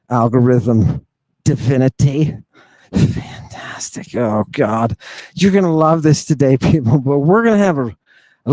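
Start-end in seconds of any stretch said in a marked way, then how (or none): 2.9-5.0 whispered aside. Real, not acted. Sarcastic aside reacting to clip.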